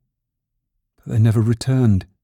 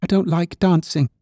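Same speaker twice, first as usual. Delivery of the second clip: higher little rabbit voice; reluctant little rabbit; 8 semitones higher than usual. A narrator's reading. Toy rabbit refusing to dance, reluctant high voice. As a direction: make an excuse in a small, high voice.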